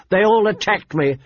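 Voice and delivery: insistent accusation from a prince; Joffrey insisting. Acted. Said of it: "Prince Joffrey insisted".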